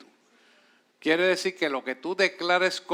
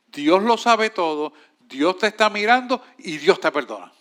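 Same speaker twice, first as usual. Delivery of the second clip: preaching; 3 semitones higher, 6 dB louder than usual. Real, not acted. Preacher stresses God sees all.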